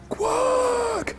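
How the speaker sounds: high, long drawn-out quack; high-pitched duckling quack, held long; sleeping duckling. Acted. Duckling quacks while falling asleep.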